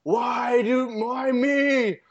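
high, loud wail to the sky; anguished cry. Retold or imitated. Old man wailing at God after the crash, loud and high. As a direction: cry out to God in mock despair. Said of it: "He's like".

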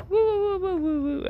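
very high falsetto woo calls; imitated eerie whooping noise. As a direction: mimic a strange night noise in piercing falsetto hoots. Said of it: "I can't even replicate it".

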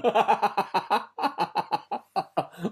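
high, breathy giggle; high laugh. Real, not acted. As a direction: burst into giggles. Laughs at pun.

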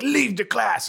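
mock German-accented dismissal. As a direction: imitate a teacher ordering someone out in a German accent. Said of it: "like, a German accent".

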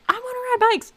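high pleading I wanna ride bikes; whiny childlike plea. Retold or imitated. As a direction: beg like a kid for a treat. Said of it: "and I was like".

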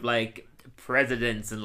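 ordinary delivery

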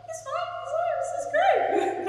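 squeaky, very high and loud forced-cheerful voice; strained high sing-song pretending all is fine; really high voice. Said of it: "Like that really high voice".

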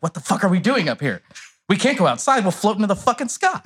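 high-pitched, freaked-out retelling; mock alarm, voice climbing high; panicked. Panicked quote while stuck at ceiling.

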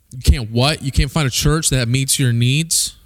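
usual speaking voice

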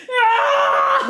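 high shrieking charge yell; battle cry. Pretend. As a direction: shriek like a tiny warrior. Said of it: "I'm just like".

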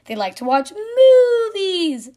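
high, loud, slow sing-song punchline; kid's punchline. Retold or imitated. Son telling his favorite cow joke, high sing-song. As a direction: deliver it like a proud little kid. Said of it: "And he says".

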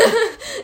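high bursting laugh. Real, not acted. Laughing after singing a tune.

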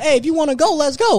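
high, loud challenge; daring them. Retold or imitated. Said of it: "and I was like".

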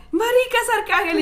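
high laughing squeal of disbelief; excited squeal. Retold or imitated. Friend recognizing the singer in the club, excited squeal. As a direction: squeal it like you can't believe it.

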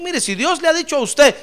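high, loud, rising proclamation; full-force preaching. Real, not acted. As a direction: build to a shouted sermon climax. (predicación encendida a gritos)

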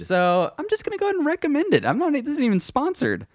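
high and fast; excited. Real, not acted. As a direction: rush it out, very excited.

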